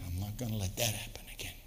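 fast, hushed whispered vow; a soft whispered promise never to be hurt again; determined whisper. Retold or imitated. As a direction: whisper it to yourself, resolved. Hurt person's private vow.

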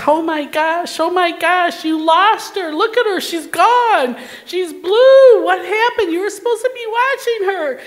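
high, loud, panicked scolding; retold screaming. Therapist screams at the nurse who lost her patient, retold screaming. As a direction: act out a frantic telling-off, pitch climbing. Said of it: "she started screaming at the nurse".

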